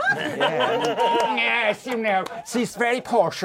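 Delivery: high, drawn-out posh camp voice; Kenneth Williams. Acted. Comedian showing the other actor inside his impression, high posh voice. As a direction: slide up into very posh comic tones. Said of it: "there's a bit of Kenneth Williams in there".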